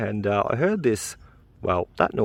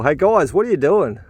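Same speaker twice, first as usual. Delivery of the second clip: raised, higher call across the yard; 7 semitones higher, 4 dB louder than usual. Real, not acted. Dad calls out to his boys busy with sticks, raised call.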